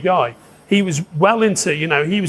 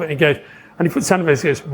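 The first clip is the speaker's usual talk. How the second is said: usual speaking voice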